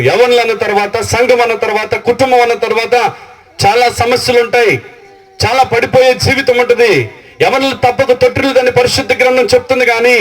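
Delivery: high, ringing build of promises; fervent preaching. Acted. Pastor promising childless believers God's blessing, fervent preaching.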